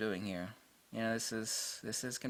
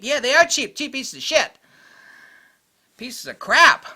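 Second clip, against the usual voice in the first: loud, high ranting yell; exasperated retort; 13 semitones higher, 12 dB louder than usual. Real, not acted. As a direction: fire back fed up, punching the insult. Exasperated retort in his rant.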